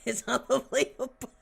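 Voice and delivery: "unbelievable" said through a laugh; cracking up at the absurd news. Real, not acted. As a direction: laugh it out in disbelief.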